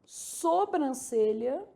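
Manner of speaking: Sobrancelha said very slowly, syllables stretched; Sobrancelha, slow and carefully sounded out; careful word demonstration. Shown as a demonstration. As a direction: draw out Sobrancelha for dictation. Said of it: "marcando as sílabas tônicas aqui".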